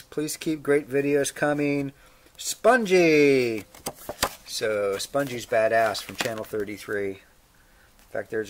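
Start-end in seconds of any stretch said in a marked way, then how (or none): 2.4-3.6 loud, high, drawn-out name; called-out sign-off. Acted. Reading out a fan's nickname at the letter's end, called out loudly. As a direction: holler the nickname like a signature flourish.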